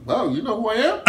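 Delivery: high, laughing ironic wow; laughing disbelief. Retold or imitated. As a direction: laugh through the question, amazed. Laughing retelling of his reply.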